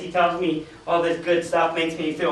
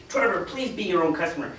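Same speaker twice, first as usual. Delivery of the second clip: ordinary talk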